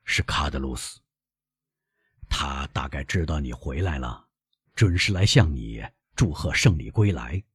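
lightly voiced, low old man; cautious old father. Old father announces a visitor.

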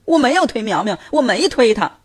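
put-on high, crying denial from a little boy; crying child. A narrator's reading. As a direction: bawl out the lie. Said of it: "豆豆哭得更响了".

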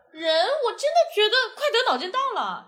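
laughing. Retold or imitated. Retelling her reply to police.

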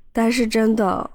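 ordinary talk, nothing marked

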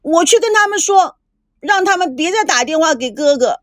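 put-on high, upset girl calling out; distressed cry. A narrator's reading. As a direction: cry it out, hurt and desperate. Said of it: "伤心地喊着".

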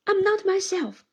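lightly tearful, pushing him away; mild tearful protest. A narrator's reading. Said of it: "burst into such tears".

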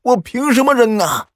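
high, loud shouting back and forth; voiced argument. A narrator's reading. Son and old father quarrel over the medicine, loud and high.

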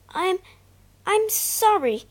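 high, halting stammered sorry; stammering apology. Acted. Peter stammering his apology to Heidi and Clara. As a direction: stumble over the apology. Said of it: "he stammered".

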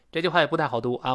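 ordinary delivery

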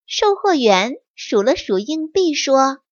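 usual speaking voice

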